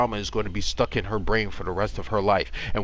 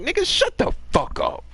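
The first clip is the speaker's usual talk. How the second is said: 1 dismissive retort. Retold or imitated. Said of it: "I'm like".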